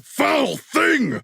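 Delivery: high, ringing cry at the foe; battle shout. Acted. Warrior shouting at a foul enemy, high shout. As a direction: hurl it at the monster like a warrior.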